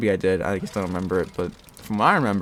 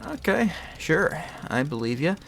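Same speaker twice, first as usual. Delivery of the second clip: sarcastic; 6 semitones higher than usual. Retold or imitated. Doubting the staff's promise.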